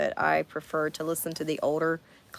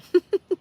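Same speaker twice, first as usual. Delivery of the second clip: laugh; 13 semitones higher, 8 dB louder than usual. Real, not acted. Bursts out laughing at herself.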